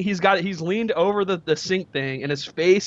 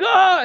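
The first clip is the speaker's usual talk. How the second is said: high, loud mock yell of alarm; retold yell; 14 semitones higher, 6 dB louder than usual. Retelling himself panicking at the spray, high yell. Both yelling at spraying bidet.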